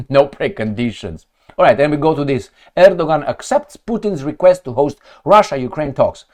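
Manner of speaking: ordinary talk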